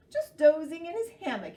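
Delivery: high, loud squeaky kangaroo. Acted. Kangaroo teasing Sloth, high and loud. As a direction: pipe it up high like a bouncy kangaroo. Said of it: "added Kangaroo".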